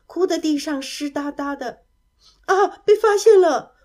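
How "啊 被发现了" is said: high, loud startled cry. A narrator's reading. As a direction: yelp as if caught hiding. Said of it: "乌鲁吓得躲进了". (吓了一跳地高声惊叫)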